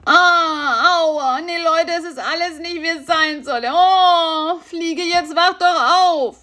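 high, moaning spider voice; a small spider groaning with toothache; whiny spider in pain. Acted.

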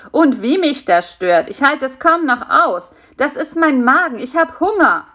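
high-pitched, put-upon fly voice; grumpy hungry fly. Acted. Fly grumbles to spider about its rumbling stomach, grumpy. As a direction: complain as a starving little fly. Fly character complains of hunger.

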